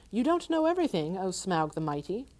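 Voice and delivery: a small hobbit plucking up courage; nervous hobbit. Acted. Bilbo voiced higher.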